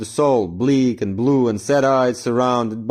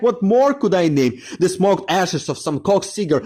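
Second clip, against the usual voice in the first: higher, fervent address to a battered flower; impassioned poem address; 6 semitones higher than usual. Acted. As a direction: declaim it with rising ardor, as if pleading.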